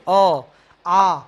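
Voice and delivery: loud sustained अ then आ; teacher sounding out vowels; vowel demo. Shown as a demonstration. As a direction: call out the open vowels अ and आ clearly. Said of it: "देखो अ बोलने में कहीं रुकावट आई?".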